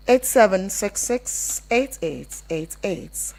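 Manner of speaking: ordinary talk, nothing marked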